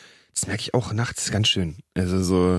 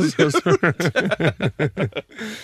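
high amused laugh; 5 semitones higher than usual. Real, not acted. Host laughs at his co-host's teasing, high and amused. Laughs at joke about excuses.

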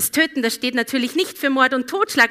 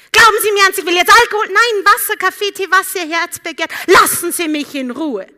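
loud, high, indignant snapping; rude passenger; 7 semitones higher, 9 dB louder than usual. Retold or imitated. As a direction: bark it out rudely. Rude passenger snaps at drink offer.

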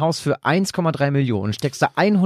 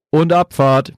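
shouted over the wind, higher; bellowing; 5 semitones higher, 6 dB louder, slower than usual. Acted. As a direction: call it out loud over a storm. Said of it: "brüllte er durch die ihm entgegenwehende Windböe".

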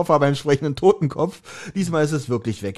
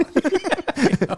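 real laugh; 10 semitones higher than usual. Real, not acted. Laughing at own impression.

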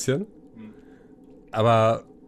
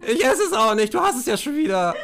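higher-voiced, offended cellmate; huffy prisoner; 12 semitones higher, 3 dB louder than usual. Prisoner refusing the meat, huffy. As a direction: argue back in a huff.

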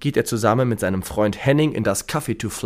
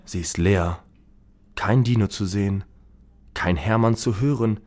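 usual speaking voice

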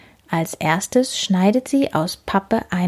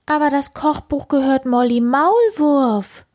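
calling out; 6 semitones higher than usual. Acted. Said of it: "ruft sie".

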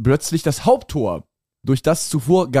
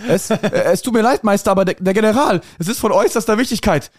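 nervous servant; 6 semitones higher, faster than usual. Acted. Messenger pleads with master.

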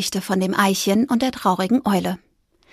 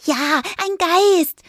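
slow, calming mouse voice, higher; soothing; 8 semitones higher, much slower than usual. A narrator's reading. Fipper calms frightened Fridolin, slow soothing reply. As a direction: reassure gently, draw it out. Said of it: "beschwichtigte Fipper ihn".

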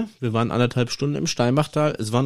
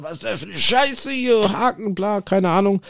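imitating a ranting buddy, much higher; mock rage; 9 semitones higher than usual. Retelling his fishing buddy's outburst, mock ranting. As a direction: rant like the furious friend, pitched way up. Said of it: "am Ragen, am Ausrasten".

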